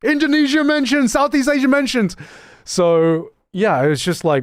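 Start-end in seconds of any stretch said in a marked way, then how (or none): 0.0-2.2 hammed-up hype, pitched way up; hyped chatter. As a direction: cheer it like an overexcited chat spammer. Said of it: "I'm that chatter to be like".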